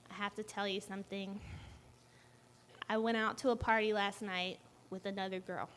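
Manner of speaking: low, hushed retold confession; grave confession. Boyfriend sitting girlfriend down to confess, quiet and serious.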